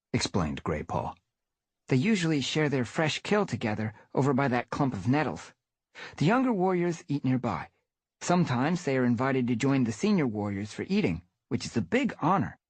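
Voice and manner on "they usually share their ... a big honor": put-on lightly raised young tomcat voice; eager young cat explaining; young apprentice cat. Grey Paw, young apprentice voice.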